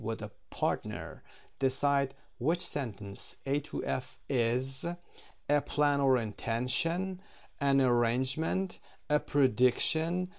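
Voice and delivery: slow, clear reading of the task; slow instruction reading. Shown as a demonstration. Teacher reading the exercise instructions, slow and clear. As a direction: read the exercise out slowly. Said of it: "reading the task instructions".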